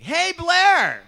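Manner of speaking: very high, very loud holler; blaring shout. Retold or imitated. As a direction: blare the greeting at him like a horn. Shouting at probation officer named Blair.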